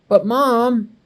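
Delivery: put-on protesting child. Boy protesting to mom about the bus, whiny and high. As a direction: beg off like a reluctant kid.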